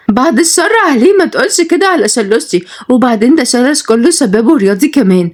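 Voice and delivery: high-pitched, indignant woman defending her man; protesting sweetheart. Acted.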